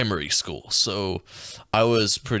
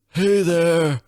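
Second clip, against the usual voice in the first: mock principal; 5 semitones higher, 4 dB louder than usual. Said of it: "They didn't sound like this".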